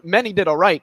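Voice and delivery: ordinary delivery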